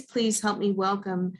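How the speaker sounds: ordinary talk, nothing marked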